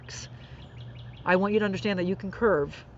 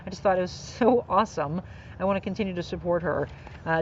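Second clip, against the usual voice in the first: ordinary talk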